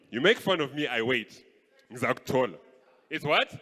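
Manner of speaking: usual speaking voice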